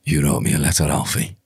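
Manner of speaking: low, gravelly Peaky Blinders gangster; a low Birmingham gangster line, lightly done; Tommy Shelby scream. Acted. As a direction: snarl it at Alfie like Tommy Shelby. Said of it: "one of the screams from".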